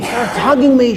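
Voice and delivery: normal talking voice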